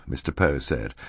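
usual speaking voice

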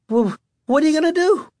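high, quick alarmed cry; shouting over thunder. A narrator's reading. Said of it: "shouting over a burst of thunder".

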